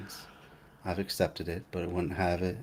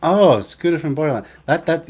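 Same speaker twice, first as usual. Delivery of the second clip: usual speaking voice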